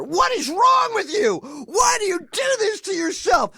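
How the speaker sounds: high, loud, exasperated yelling at himself; furious shout. Retold or imitated. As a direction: blow up at yourself in disgust. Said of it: "he did the Ed Till routine".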